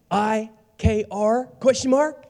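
high, slowly spelled-out letters, rising; mock texting excitement. Gossip texting back a reply, exaggerated high excitement.

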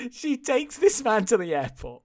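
ordinary delivery

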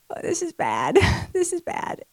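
soft, helpless laugh through the words; oh-no giggle, under the voice; dismayed laughter. Retold or imitated. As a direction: laugh weakly at a looming disaster. Retelling her dismayed laughing reaction.

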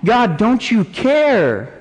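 high pained plea, building to a drawn-out end; anguished cry of doubt; raw questioning of God. Acted. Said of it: "to be that raw with our pain".

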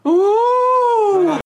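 high, loud whooping whale cry; a long hooting whale moan; whale call. Pretend. Doing a whale impression for the lads on Skype, a high whale call. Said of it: "I can do a whale".

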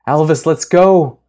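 high, loud whoop of relief; joyful shout. Acted. Grace cheering the car as they escape, high joyful shout. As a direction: shout it with pure joy. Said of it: "Grace screamed joyfully".

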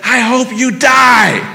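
imitated driver shouting slowly; shouted curse. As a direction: yell it after them from the road. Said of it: "somebody says".

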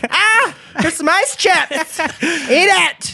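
very high, slow and startled; high squeaky voice. Retold or imitated. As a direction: squeak it out nervously. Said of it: "You're like".

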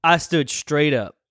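ordinary talk, nothing marked

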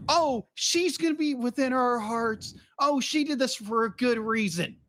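high, drawn-out mock-earnest quote; syrupy parody of consolation; mock sentimental. Mocking the characters' sentimental excuses.

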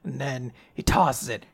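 ordinary talk

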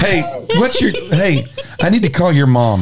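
lightly voiced parent warning a mouthy kid; mock parent threat. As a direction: play the fed-up parent, half-laughing.

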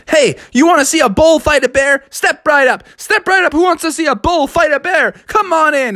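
high, eager carnival tout; ticket barker. Acted. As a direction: drum up a crowd for the show. Traders tout tickets to passers-by.

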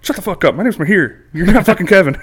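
imitating an annoyed friend telling off a telemarketer; mock angry retort. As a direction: snap back at the caller, building. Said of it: "And he'd be like".

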